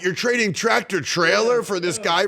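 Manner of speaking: high, incredulous outburst on everyone's behalf; mock disbelief. Host voicing everybody hearing of the trade, mock disbelief. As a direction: imitate fans baffled by a trade.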